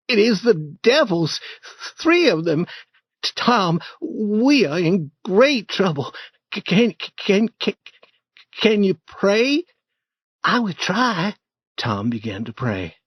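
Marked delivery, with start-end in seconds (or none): 0.1-9.6 put-on a terrified boy stumbling over can; shaking with fear. Huck begging Tom to pray, stammering in fear. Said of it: "shaking more". 10.4-11.3 put-on high boyish voice, urgent; a startled boy pointing out a noise; young Tom, alarmed. Tom nudging Huck about a noise, high alarmed boy.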